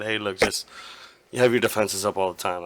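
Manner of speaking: ordinary talk, nothing marked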